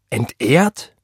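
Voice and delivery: scornful echo, called out; incredulous cry. A narrator's reading. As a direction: throw the word back in disbelief. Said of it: "rief er".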